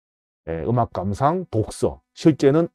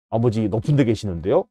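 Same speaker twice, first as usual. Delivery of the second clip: quieter and lower, a timid pupil's reply; a shy kid mumbling up to the teacher; child answering; 5 semitones lower, 5 dB quieter than usual. Retold or imitated. A pupil answers his teacher, small and quiet.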